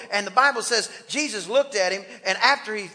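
ordinary talk

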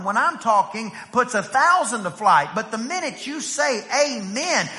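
normal talking voice

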